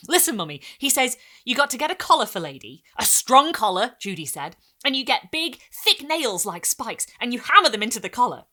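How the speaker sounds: loud, high excited telling; eager child. A narrator's reading. Girl eagerly explaining to mum, loud. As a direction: rush the story out eagerly.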